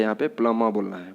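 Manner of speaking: ordinary talk, nothing marked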